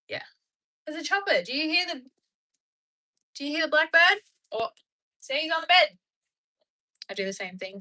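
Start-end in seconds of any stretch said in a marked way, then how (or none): 0.9-6.0 high, loud chatter to a pet; excited pet talk. Real, not acted. Streamer showing the bird what's outside, high and loud. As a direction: point things out brightly to a pet.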